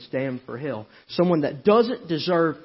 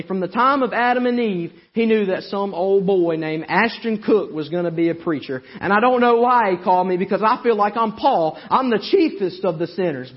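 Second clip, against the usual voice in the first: raised, driving preacher's cry; fiery preaching; 8 semitones higher, 8 dB louder than usual. Acted. Preacher shouting to the congregation about Jesus. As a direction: preach it out full force to the church.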